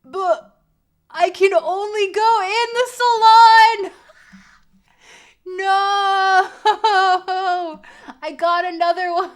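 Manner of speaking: high, loud laughing shriek of disbelief; shrieking outburst. Real, not acted. Streamer yelling at the game that locked her in, shrieking. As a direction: scream it out half laughing.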